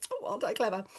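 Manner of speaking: soft, self-satisfied aside; quietly smug child. Retold or imitated. Teacher voices children who don't need help, quietly smug. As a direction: murmur it as a pleased little pupil.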